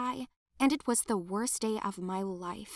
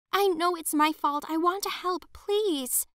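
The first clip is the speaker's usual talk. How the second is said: much higher, anxious pleading voice; pleading girl; 8 semitones higher, 3 dB louder than usual. Acted. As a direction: beg to be heard, hands up.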